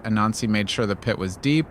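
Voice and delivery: ordinary delivery, nothing marked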